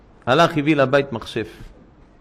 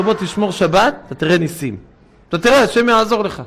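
urging; 7 semitones higher, 5 dB louder than usual. Retold or imitated. Rabbi urging driver to keep Shabbat.

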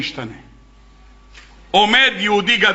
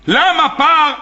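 surprised question; 6 semitones higher, 4 dB louder, much slower than usual. Acted. Elijah surprised at bull.

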